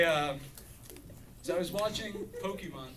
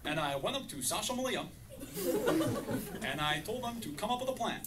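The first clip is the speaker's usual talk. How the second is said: lightly voiced, slightly higher Obama; a hint of presidential Obama, measured and a bit higher; Barack Obama impression; 6 semitones higher, 5 dB louder than usual. Obama answers his wife about the trash, lightly imitated. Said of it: "impression of, uh, Barack Obama".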